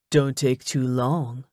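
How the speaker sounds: low, slow male character voice; smug ex-boyfriend. A narrator's reading. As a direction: drop low and take your time, smugly.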